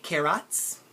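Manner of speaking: carrots said as care-OTS; over-pronounced ending on carrots, care-OTS; full O vowel. Shown as a demonstration. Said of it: "But do you say carrots?".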